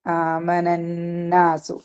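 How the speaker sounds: very slow, drawn-out chanted recitation; slow Arabic tajweed chant; Quranic recitation. Shown as a demonstration. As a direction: chant it out slowly, holding every sound. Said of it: "അവിടെ നാ-, നൂനിന് എന്തുണ്ട്?".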